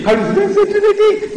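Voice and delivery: very high, squeaky weakling voice; mock chicken voice. Shown as a demonstration. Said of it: "that chicken voice".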